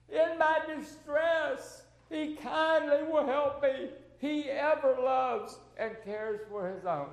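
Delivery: high, impassioned declaration of hymn words; emotional hymn recitation. Real, not acted. Preacher reciting a childhood hymn to congregation, emotional. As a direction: proclaim each line with rising emotion, drawn out. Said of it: "excuse me if I get a little emotional".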